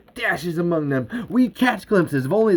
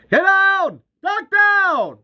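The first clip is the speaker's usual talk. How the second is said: high, urgent warning cry; urgent shout; 13 semitones higher, 3 dB louder, much slower than usual. Acted. Fighter warning friends of oncoming creatures, urgent shout. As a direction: yell it to save your friends.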